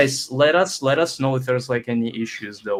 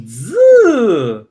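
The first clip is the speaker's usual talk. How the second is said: loud buzz; 10 semitones higher, 12 dB louder than usual. Pretend. Buzzing noise as a hype sound.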